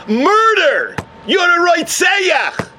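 high, stretched-out cry of accusation; thundering accusation. Acted. Heavenly voice accuses the man of murder, high drawn-out cry. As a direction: hurl the charge slowly and high.

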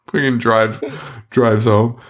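normal talking voice

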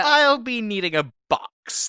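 high, slow, prim diner; drawn-out fussy request; customer voice. Pretend. Restaurant bit continues.

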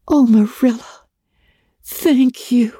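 high, overjoyed thanks; a girl hugging her guardian; grateful rush. A narrator's reading. Anne thanking Marilla, high and overjoyed.

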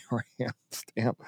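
laughing. Real, not acted. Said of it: "I just like saying it".

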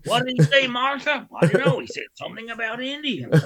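high mock tourist couple chatting; American couple. Imitating the couple inside the car.

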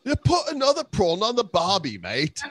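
hammed-up Aussie accent, pitched high; mock Australian accent. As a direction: toss off an Aussie cliché, high. Sudden Australian cliché.

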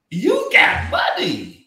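drawn-out, loud exclamation; big playful accusation; teasing amazement. Retold or imitated. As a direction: stretch it out in mock amazement. Teasing exclamation about friend's wealth.

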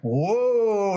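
loud, high mock gasp of awe; a stunned whoa from the room; surprised whoa. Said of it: "Everybody was like".

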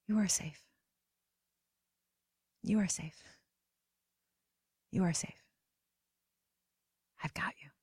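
soft reassurance. Pretend. Mother softly repeating safety to her crying inner child. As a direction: lull her gently, almost a whisper. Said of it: "And so I repeat to her".

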